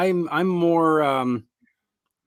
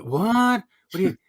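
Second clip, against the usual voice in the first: high shocked what; shocked fan; 7 semitones higher than usual. Retold or imitated. Fan hearing about ghost players, high shock. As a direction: sound stunned by the news.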